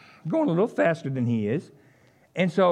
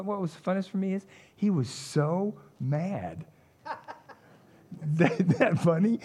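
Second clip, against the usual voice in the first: laughing through the words; amused giggle at the driver's anger; 4 dB quieter than usual. Real, not acted. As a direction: chuckle as you tell it. Laughing at the memory.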